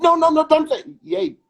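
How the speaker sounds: high, loud mock alarm, hands-up pleading. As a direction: squeal it like a shocked churchgoer. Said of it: "the Christians might think".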